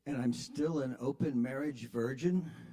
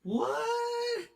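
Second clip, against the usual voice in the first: much higher, startled squeak; squeaky what. Retold or imitated. Pinned driver confused by the Grover demand, startled squeak. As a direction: yelp it in confusion. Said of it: "say it like Grover".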